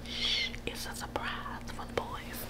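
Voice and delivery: hushed, barely audible whisper; secretive whisper. Real, not acted. Mom hiding a surprise from the boys, secretive whisper. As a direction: whisper it so nobody overhears.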